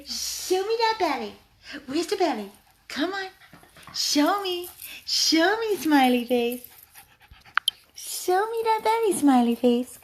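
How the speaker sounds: very high, excited cooing; gushing, sing-song pet talk; high-pitched dog talk. Real, not acted. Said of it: "He loves when I talk all high pitch".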